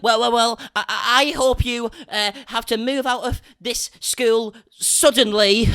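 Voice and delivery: mock a flustered schoolkid hitting back; halting, high-pitched schoolkid retort; arguing high schooler. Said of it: "sounds like high schoolers arguing".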